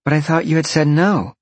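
high, incredulous character voice; surprised exclamation. A narrator's reading. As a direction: protest in high-pitched surprise. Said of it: "Roxy exclaimed".

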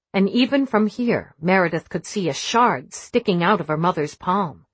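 ordinary delivery

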